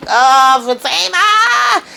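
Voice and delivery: a high, drawn-out, stretched cry of her name; shocked. Retold or imitated. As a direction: gasp her name in slow disbelief. Narrator reacts in scene aloud.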